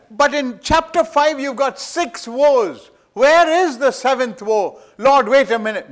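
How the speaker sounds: loud, high, impassioned sermon voice; thundering pulpit preacher; full-force preaching. Acted. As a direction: preach it with rising fire. Preaching on the seventh woe.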